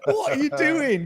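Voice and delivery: high, slow, laughing outburst; startled outburst. Retold or imitated. Camper woken by a drunk at the tent, shocked. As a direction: demand it in half-laughing shock.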